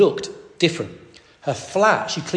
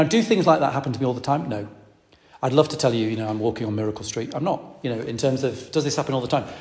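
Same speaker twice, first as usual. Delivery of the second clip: ordinary talk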